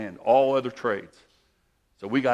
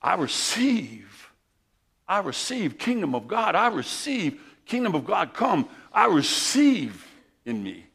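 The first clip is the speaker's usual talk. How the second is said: rising, louder preacher's cry, slow on the opening lines; preaching at full force; 9 semitones higher, 7 dB louder than usual. Acted. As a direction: proclaim it to the congregation like a revival preacher.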